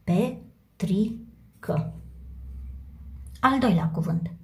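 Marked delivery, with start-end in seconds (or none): 0.0-1.9 very slow, syllable by syllable; slow dictation. Shown as a demonstration. Teacher repeating the name for the class, slow. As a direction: draw out each syllable as pupils write. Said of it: "teacher dictates slowly".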